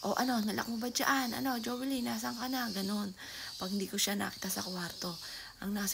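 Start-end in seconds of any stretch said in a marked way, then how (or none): 0.1-2.7 higher, louder impatient yell; retold yelling. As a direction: yell for her, a bit annoyed. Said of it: "isigaw agad ako". (pasigaw na hinahanap si Jovelyn)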